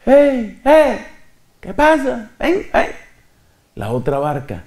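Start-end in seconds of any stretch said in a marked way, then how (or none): 0.0-2.9 high shouted hail across the water; calling out. Retold or imitated. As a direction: call out to the other boat. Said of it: "Tuvieron que empezar a gritar".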